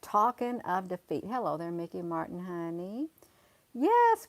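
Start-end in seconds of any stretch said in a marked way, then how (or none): 3.7-4.3 high, loud exclamation; emphatic yes. Real, not acted. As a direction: burst out with a yes.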